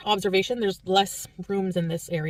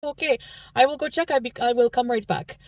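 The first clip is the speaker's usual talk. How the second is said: French Canadian volunteer voice, pitched higher; French Canadian accent; 4 semitones higher than usual. Retold or imitated. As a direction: do the nice old lady with her French Canadian lilt. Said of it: "try to imitate it".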